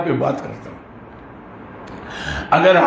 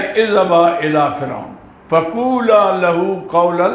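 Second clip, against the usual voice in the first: slow, measured Arabic recitation; Quran recitation; slower than usual. Real, not acted. Preacher quotes God's command to his listeners, slow recitation. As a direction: recite with drawn-out vowels.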